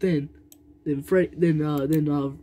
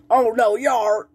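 loud high mock yell; higher, louder play yell; battle yell; 7 semitones higher, 5 dB louder than usual. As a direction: yell it like a cartoon fighter. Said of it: "So he's like".

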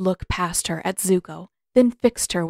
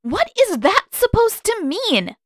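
much higher, slow angry demand; 14 semitones higher, 4 dB louder than usual. A narrator's reading. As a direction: voice climbing as she demands. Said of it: "Katara demanded, voice creeping upward".

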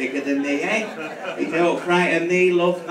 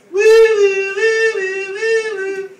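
high, loud woo woo like a police siren; siren imitation; 13 semitones higher, 8 dB louder, slower than usual. Retelling being pulled over, singing the siren. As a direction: wail it like blue lights flashing.

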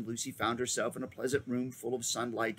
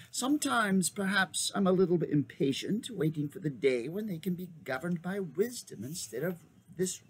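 put-on old magician voice; 6 semitones higher than usual. A narrator's reading.

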